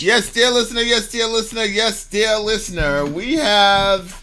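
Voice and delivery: loud, high show-host build-up; hammed-up announcer. Host introduces a band to dear listener, hammed-up announcer. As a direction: draw out the introduction grandly.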